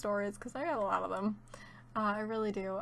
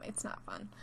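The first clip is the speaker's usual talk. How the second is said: whispering; 12 semitones lower, 10 dB quieter than usual. Real, not acted. Dejected aside after shoplifting warning.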